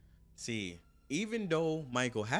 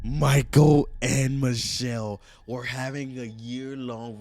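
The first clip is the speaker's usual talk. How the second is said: slow and much louder Michael and Michelle; loud dramatic reveal; 12 dB louder, slower than usual. Acted. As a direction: announce it like a headline.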